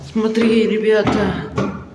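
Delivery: normal talking voice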